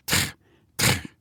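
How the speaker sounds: plain reading voice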